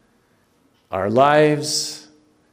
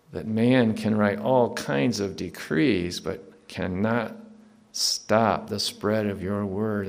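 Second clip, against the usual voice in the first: softer, even-pitched prayer voice; closing prayer; 2 semitones lower, 4 dB quieter than usual. Real, not acted. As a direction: pray earnestly, subdued after the preaching.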